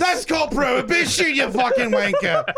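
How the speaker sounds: hammed-up British accent, laughing through it. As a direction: yell at him like an angry British lad. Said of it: "He was doing U K".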